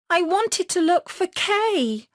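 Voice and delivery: put-on high, bright child voice in distress; a girl remembering her friend; little girl's voice. Gerda resolving to look for Kay. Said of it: "said the little girl".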